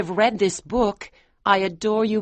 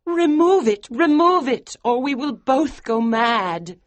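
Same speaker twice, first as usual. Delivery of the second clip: very high, loud, then slowing; desperate demand; 13 semitones higher, 5 dB louder than usual. Acted. Wife begging husband to remove birthmark, frantic loud. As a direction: beg him to remove it, frantic.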